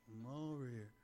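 low, soft acted answer; mock muted reply. Acting out a kid and parent exchange, mock muted reply. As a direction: drop low and quiet, imitating a reply.

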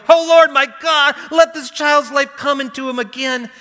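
high, loud pleading cry to the Lord; desperate loud prayer, pitched high; crying out to God. Acted. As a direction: cry out to God with all your strength.

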